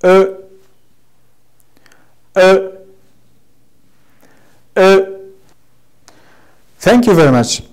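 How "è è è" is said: "E" as a short uh from a high start; fourth tone. Shown as a demonstration. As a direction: cut each high "E" short. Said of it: "The last one is".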